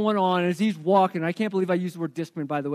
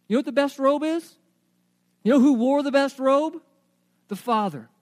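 normal talking voice

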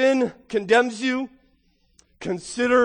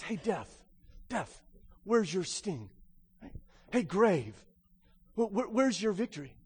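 mild mocking taunt, softer; 8 dB quieter than usual. Paul taunting death, a soft mocking voice. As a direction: tease death lightly. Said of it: "Paul taunts death".

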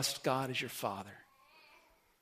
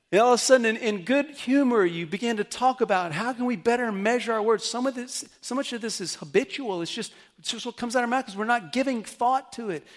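louder and higher, driving sermon build; full-force preaching; 7 semitones higher, 7 dB louder than usual. Acted. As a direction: preach it hard to the congregation.

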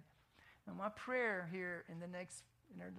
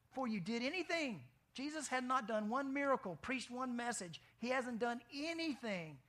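emphatic preaching; 6 semitones higher, 4 dB louder than usual. Real, not acted. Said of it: "emphatic preaching rise".

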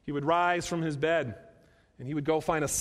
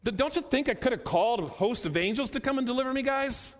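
higher, quick rhetorical challenge; incredulous challenge; 6 semitones higher, faster than usual. Retold or imitated. Preacher voicing Jesus to his arresters, high incredulous challenge. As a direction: put it to them as an acted retort.

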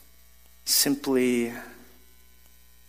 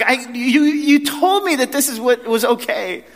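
much higher, louder indignant wife; mock-indignant wife, voice up high; wife's exasperated protest; 11 semitones higher, 6 dB louder than usual. Pastor imitating his wife's indignant protest, much higher and louder.

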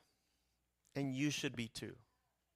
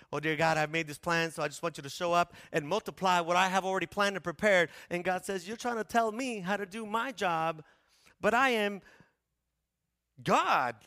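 raised preacher voice building to a big God; forceful preaching; 6 semitones higher, 9 dB louder than usual. Acted. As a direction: build from mock prayer to God's loud rebuke. Acts prayer and God's rebuke.

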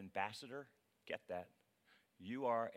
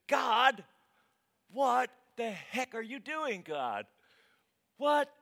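loud, high-pitched rant at God; outraged protest, hammed up; exasperated prayer; 9 semitones higher, 10 dB louder than usual. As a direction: shout your complaint up to heaven. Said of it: "Have you ever had this prayer?".

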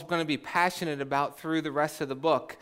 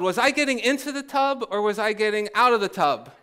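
higher, quicker bewildered yell; mock yell; 5 semitones higher, faster than usual. Oldest sister stuck at the bathtub, mock yell. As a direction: call it out as a puzzled old sister. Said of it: "then she yelled".

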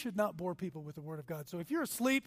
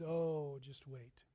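softer, lower, knowing; a quiet just wait; understated calm warning; quiet knowing reply; 6 semitones lower, 5 dB quieter, much slower than usual. Retold or imitated. Jesus answer, quiet.

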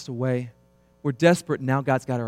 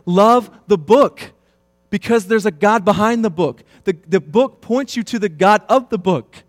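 fervent exhortation; 6 semitones higher, 9 dB louder than usual. Acted. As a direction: proclaim it like a charge to the flock. Preacher urging congregation to love Scripture.

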